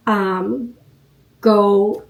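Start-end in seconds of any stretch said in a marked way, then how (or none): none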